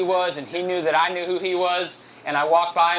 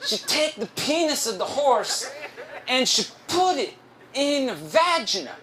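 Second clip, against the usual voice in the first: high, slow coworker impression; halting singsong, each phrase swooping high; Ricardo; 7 semitones higher than usual. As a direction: carry on obliviously in his drawn-out put-on voice. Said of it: "I'm gonna do his voice".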